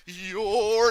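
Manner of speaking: high, loud, held-out ejection call; imitated ref's big announcement; mock referee call. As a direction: wind up like a ref throwing someone out.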